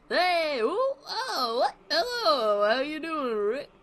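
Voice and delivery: excited dog greeting the host; Barnaby voice. Acted. Barnaby greeting the host Rick, loud and goofy. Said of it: "how am I gonna do Barnaby?".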